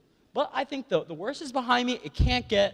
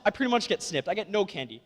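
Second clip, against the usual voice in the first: ordinary talk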